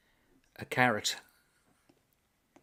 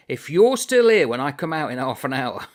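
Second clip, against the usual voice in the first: louder, threatening; wife's threat; 3 semitones higher, 9 dB louder than usual. Acted. The battle-axe wife threatening her husband, loud. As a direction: make it a warning.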